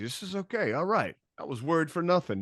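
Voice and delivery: high, sing-song self-reassurance; breezy relief, acted out; mock relief. Acting out his soundcheck relief.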